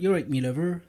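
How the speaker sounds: put-on West Country accent. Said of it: "like a farmer".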